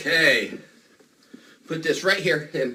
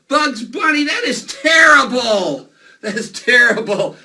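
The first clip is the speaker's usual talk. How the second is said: loud, drawn-out groaning protest; mock outrage; 5 semitones higher, 8 dB louder than usual. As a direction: bellow at an awful joke. Mock outrage at drawing's bad joke.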